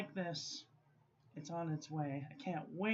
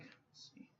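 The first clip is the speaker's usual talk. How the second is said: whispered aside; 18 dB quieter than usual. Real, not acted. Counting pens under breath.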